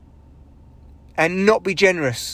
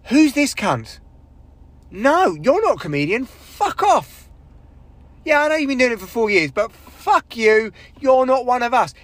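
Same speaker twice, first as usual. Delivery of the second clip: hostile crowd voice; 6 semitones higher than usual. Retold or imitated. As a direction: spit it out like a room that hates you. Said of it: "the room collectively went".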